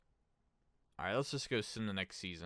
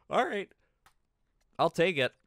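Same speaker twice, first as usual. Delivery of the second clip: much higher and louder, thrilled; excited outburst; 10 semitones higher, 12 dB louder than usual. Real, not acted. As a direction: burst out happily at good news. Surprised by cap space.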